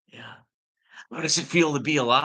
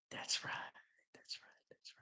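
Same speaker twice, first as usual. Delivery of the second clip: breathy whispered aside; a hushed, secretive that's right; 21 dB quieter than usual. Real, not acted. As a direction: whisper it to yourself. Whispered agreement to self.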